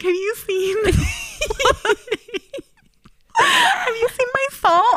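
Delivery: high, laughing mock question from Josh; laughing Josh quote. Roommate asking about his missing salt, laughing. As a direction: squeeze the question out through laughter.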